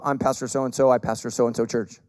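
low, lightly mimicked formal introduction; routine pastor introduction.

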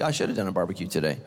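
a hint of a distracted congregant; daydreaming churchgoer. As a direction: rattle it off like a bored mind wandering. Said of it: "You're like".

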